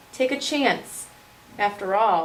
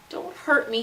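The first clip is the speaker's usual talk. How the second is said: mild whimper; 6 semitones higher than usual. Acted. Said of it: "he whimpered".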